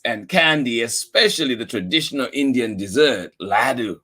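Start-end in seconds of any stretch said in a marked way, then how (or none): none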